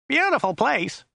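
high, nasal driver voice; Joe's nasal whine. A narrator's reading. Driver making small talk with his passenger, high and nasal. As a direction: whine it high through the nose. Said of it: "His voice was rather high and whiny".

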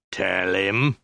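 drawn-out order with rising end; insistent prompt. A narrator's reading. Soldier pressing the shrieking woman, insistent. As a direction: insist on it, stretching the words.

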